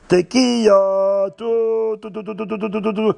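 high held note breaking into rapid toots; vocal horn blast, long then pulsing; shofar imitation. Rav blows the shofar on the ship, imitated horn calls. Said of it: "big sound of the shofar".

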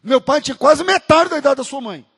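normal talking voice

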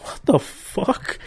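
high breathy shocked whisper. Retold or imitated. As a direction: gasp it out in disbelief. Shocked at picture on piano.

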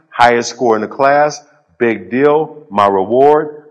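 normal talking voice

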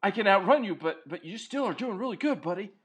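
lightly voiced gushing dog; adoring dog voice. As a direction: gush over your owner like a loyal pup. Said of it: "your dog would be like".

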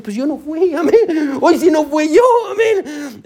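a hint of a smug Satan; mock Satan. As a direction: play an innocent little devil denying it. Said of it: "Satanás está en una esquina diciendo".

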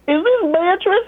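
high sweet elder asking; lightly voiced older lady; caller's voice. Caller asking for Beatrice, high and sweet. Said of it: "she's like".

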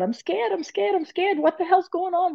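high, frightened pleading; crying out for help, scared; scared inner cry. Retold or imitated. Crying out to her guides in her head, high and frightened. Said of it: "just crying out".

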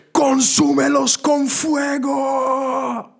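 hammed-up furious shout to the heavens; mock fiery shout. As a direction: bellow it like a wrathful prophet calling down fire. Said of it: "Perdón si eso voló tus oídos".

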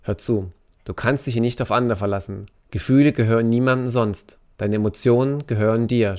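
usual speaking voice

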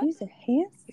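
high cooing praise; baby-voice dog talk. Shown as a demonstration. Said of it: "My dog voice is a baby voice".

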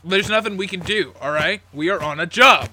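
a mildly harsh big brother insisting; firm refusal. Acted.

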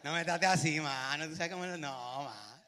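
put-on slow, drawn-out Puerto Rican accent; lightly voiced Puerto Rican guy; a hint of a Puerto Rican accent. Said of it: "Los puertorriqueños".

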